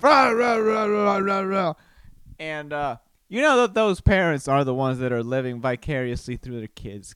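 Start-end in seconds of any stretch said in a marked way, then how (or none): 0.0-1.7 loud mock rah-rah yelling. Sideline parent mocking the ref with a loud yell. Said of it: "start yelling at the ref".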